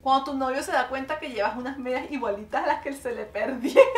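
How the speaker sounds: ordinary talk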